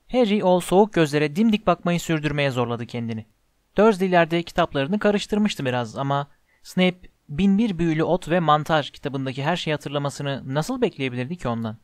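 plain reading voice

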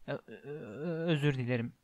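acted stammering sob; hiccuping, tearful stutter, quietly performed; sobbed apology. A narrator's reading. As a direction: choke softly on the first sound, stammering. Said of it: "diye hıçkırdı".

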